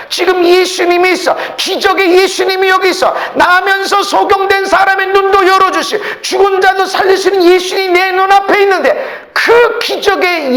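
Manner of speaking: full-force, high-pitched preaching. Acted. Preacher retelling neighbors' blame of the blind man, impassioned preaching. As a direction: drive the message home loudly, hardly letting up.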